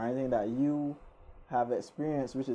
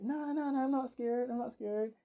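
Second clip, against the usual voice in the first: high-pitched, hurried-off denial of fear; mock scared, pitched well up; frightened denial; 11 semitones higher than usual. Narrator insisting to the robber he is not frightened, high mock-scared denial. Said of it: "As I tremble".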